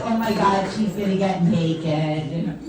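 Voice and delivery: ordinary delivery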